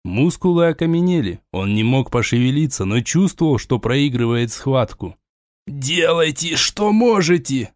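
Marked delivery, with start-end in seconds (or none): 5.7-7.7 forced out through pain, higher; strained in agony. A narrator's reading. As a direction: squeeze the words out in agony. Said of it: "мучительно выдавил он".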